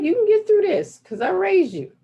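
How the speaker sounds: hammed-up bossy grandma claiming credit; grandmother. Voicing grandmother's firm words.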